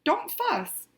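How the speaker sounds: lightly squeaked little mouse voice, pitch jumping up; a hint of a squeaky mouse. A narrator's reading. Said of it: "Mouse squeaks".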